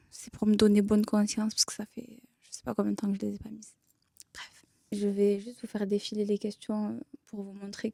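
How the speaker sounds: whispering. Real, not acted. Confiding aside about skipped aligners.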